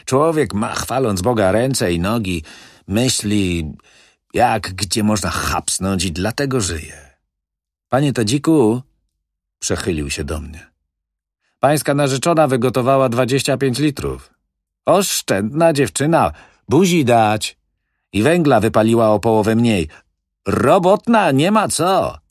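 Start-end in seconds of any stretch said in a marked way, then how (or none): 0.1-8.8 louder, higher, breezy wheeler-dealer; smooth-talking manager. Acted. Manager brushing off the old widow's fears, breezy and loud. Manager philosophises, addresses Tadzik. 11.6-22.2 loud, high, fast praise; hearty booming manager. Acted. Manager praising Tadzik's fiancée, loud and hearty.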